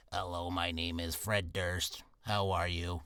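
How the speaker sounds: low, slow voice-box drone; speech box voice. Retold or imitated. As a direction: sound like an electrolarynx. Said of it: "talking through one of those speak boxes".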